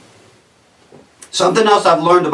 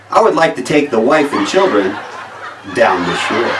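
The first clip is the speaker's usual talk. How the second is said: a lightly voiced mild Denver dad, a bit lower and softer; plain Denver dad; 6 semitones lower, 4 dB quieter than usual. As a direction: say it like a polite accountant with no Jersey accent. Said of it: "It would go something like this".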